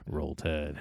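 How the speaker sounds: low, soft Roll Tide murmured right into the mic; close-mic Roll Tide. Pretend. To the listeners, murmuring the team chant close to the mic. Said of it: "I love to lean into the mic".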